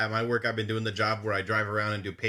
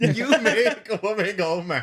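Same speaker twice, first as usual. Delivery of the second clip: laughing high voice; 7 semitones higher, 4 dB louder, slower than usual. Acted. Laughing mock line.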